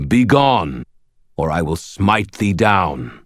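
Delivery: stern ancient giant speaking slowly; hardened deep voice. A narrator's reading. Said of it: "The deep voice hardened".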